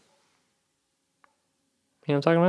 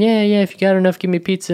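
higher, mock-friendly begging; mock friendly beggar; 7 semitones higher than usual. Driver mocking the beggar's friendly pizza line, higher. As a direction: imitate his sweet begging, higher. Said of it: "he was being all kind of friendly".

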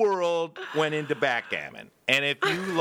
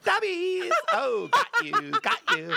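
all happy. Retold or imitated. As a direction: gloat happily, starting high. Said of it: "Like, all happy".

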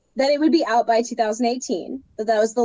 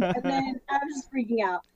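usual speaking voice

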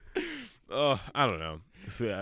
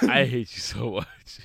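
mock outrage; 4 semitones higher, 6 dB louder, slower than usual. Real, not acted.

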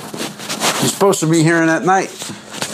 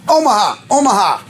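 lightly barked quarterback call; imitated barked signals; 3 semitones higher than usual. Host mimicking Peyton calling at the line, imitated bark. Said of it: "they bark shit".

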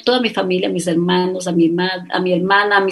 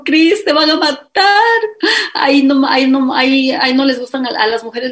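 crying plea; 4 semitones higher, 3 dB louder than usual. Retold or imitated. Brother warning his sister not to go, tearful higher plea. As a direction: imitate the brother begging her not to go. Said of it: "se puso a llorar".

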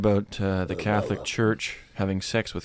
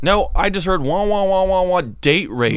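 loud, raised wah-wah babble; mocking offended listener; 7 semitones higher, 5 dB louder than usual. Comedian playing audience hearing only trigger words, mocking. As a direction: babble the filler loudly, mocking selective hearing.

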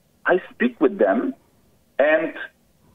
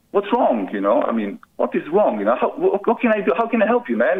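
usual speaking voice

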